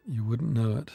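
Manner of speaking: ordinary delivery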